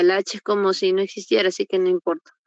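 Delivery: ordinary talk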